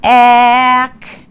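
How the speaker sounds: "Tack" stretched slow and loud, sound by sound; sounded-out word. Shown as a demonstration. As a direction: pull each sound of "Tack" apart for the class. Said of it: "let's do our roller coaster".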